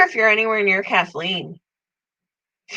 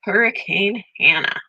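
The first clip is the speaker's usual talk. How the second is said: usual speaking voice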